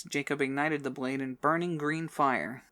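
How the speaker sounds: ordinary delivery, nothing marked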